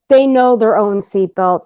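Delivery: normal talking voice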